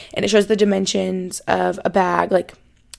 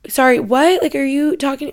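higher, louder, baffled reaction; confused; 5 semitones higher, 4 dB louder than usual. Retold or imitated. Bag owner answering the yelling family, acted confusion. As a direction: act out being thrown and bewildered. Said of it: "I'm so confused".

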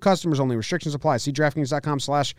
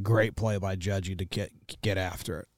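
lightly voiced manager, lower and softer; Aaron Boone impression; 5 semitones lower, 8 dB quieter than usual. Host mimics Aaron Boone praising a misplay, low and soft. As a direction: mumble it like a bland manager excuse. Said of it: "and he said".

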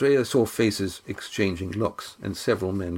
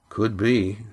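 usual speaking voice

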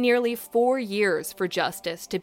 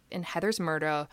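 normal talking voice

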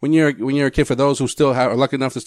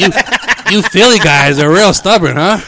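teasing; 7 semitones higher, 7 dB louder than usual. Pretend. Teasing the headlock victim.

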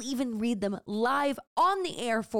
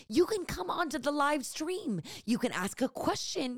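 usual speaking voice

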